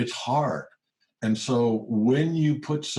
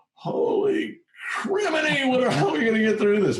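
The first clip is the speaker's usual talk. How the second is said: much higher, louder mock backyard scream; 3 dB louder than usual. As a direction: let the pent-up panic burst out in a yell. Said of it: "you go out in the backyard and you scream".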